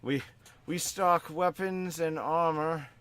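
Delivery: put-on the scared kid finally gets it out; frightened child. Shop boy frightened by the undead customer, slow and high. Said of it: "young child looks at you, a little bit frightened".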